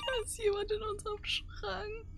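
scared hush. Real, not acted. Someone found hiding in wardrobe.

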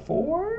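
FOUR sent sharply upward like a question; unsure rising voice. Shown as a demonstration. Student answering on grand rounds, FOUR rising unsure into a question. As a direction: let FOUR climb high, as if guessing. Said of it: "your voice to go up".

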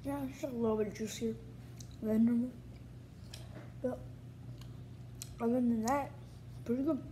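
talking between chews; chewing while talking. Real, not acted. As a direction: mouth partly full of candy. Seen 3: Puts gummy in mouth at -4; cheeks and lips chew while talking.

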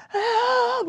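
high, loud cry of frantic exasperation; re-enacted panicked outburst; panicked retold cry. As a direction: act out a frazzled mom's high wail. Acting out panic searching purse.

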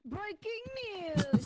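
loud, high breaking news announcer. Pretend. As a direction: call it out like a TV news anchor. Announcer parody on mic.

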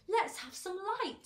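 high, loud call into the dark; shouted demand. A narrator's reading. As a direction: call out loudly for everyone to hear. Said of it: "shouted the centipede".

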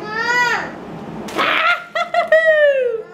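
high, drawn-out wailing laugh; high-pitched laughing cry; long laugh. Real, not acted. Lively reaction during snack haul.

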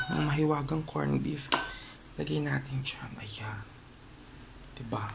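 quiet whisper. Real, not acted. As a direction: keep it hushed. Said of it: "medyo pa bebe yung boses natin".

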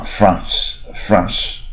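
France said slowly in a British accent, with a long back ah. Shown as a demonstration. Teacher shows the British accent's France to students, slow. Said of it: "英文比较发France".

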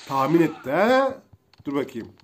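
normal talking voice

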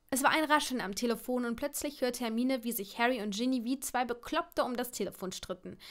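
ordinary delivery, nothing marked

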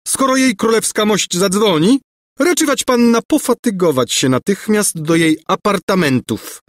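high, menacing formality; sinister order. A narrator's reading. Governess sending the servant to the queen, sinister. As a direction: order her off with icy, high-pitched politeness. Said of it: "syknęła złowrogo Gburja Furia".

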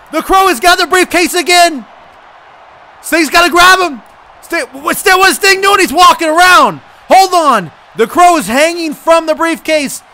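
loud, high, shouted play-by-play; worked-up commentary. Real, not acted. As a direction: get carried away calling a near win. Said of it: "I'm getting a little worked up".

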